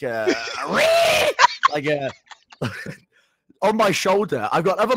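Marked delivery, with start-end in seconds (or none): 0.4-1.7 high, loud screeching monkey noise; imitated monkey screech. Mimicking the monkey on his shoulder, a high loud screech. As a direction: screech at him like an angry monkey. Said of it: "The monkey's screeching at me like".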